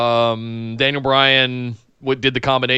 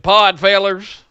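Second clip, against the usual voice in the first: pitched-up Southern twang; southern accent; 6 semitones higher, 4 dB louder, slower than usual. Shown as a demonstration. Host rereads a listener's greeting in a Southern accent. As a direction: put on a Southern accent, louder. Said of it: "in my southern accent".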